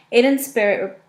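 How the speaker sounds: ordinary delivery, nothing marked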